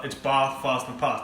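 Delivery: Bath, fast and past with long ah; posh vowels. Shown as a demonstration. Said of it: "That's called standard English".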